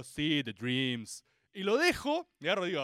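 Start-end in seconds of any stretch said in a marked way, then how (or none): 0.0-1.2 very low, slow, subdued murmur; underwhelmed mock awe. Reader finishing Sandman, low and underwhelmed. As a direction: let it drag low, barely invested. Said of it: "Y yo digo".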